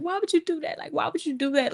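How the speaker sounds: high, mildly whiny imitation of crying; retold tearful plea. Teller asks girlfriend why, high tearful plea. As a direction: plead with a touch of mock tears. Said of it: "I'm crying, like".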